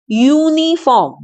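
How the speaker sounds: "यूनिफॉर्म" said a little slowly, starting with a "यू" sound; teacher showing the consonant sound; careful "यूनिफॉर्म". Shown as a demonstration. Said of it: "हम इसको बोलते हैं यूनिफॉर्म".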